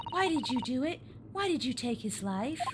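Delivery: softly voiced young assistant; Susato. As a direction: ask it quietly, without a British accent. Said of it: "I'm not giving you a British accent".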